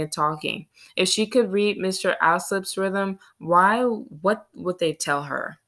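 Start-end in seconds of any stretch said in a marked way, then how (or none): none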